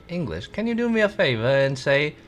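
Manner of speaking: hammed-up English accent, swooping pitch. Shown as a demonstration. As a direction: put on your best mock English accent. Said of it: "I have to get into English".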